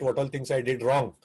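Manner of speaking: ordinary delivery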